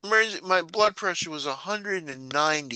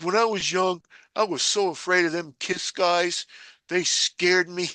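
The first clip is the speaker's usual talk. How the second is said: high-pitched guy voice; 7 semitones higher, 3 dB louder than usual. Retold or imitated. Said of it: "He's like".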